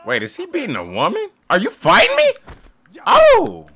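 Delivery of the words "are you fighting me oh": high startled shout, much louder; shocked yell. Real, not acted. Gamer yelping as an NPC attacks. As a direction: yelp in sudden alarm.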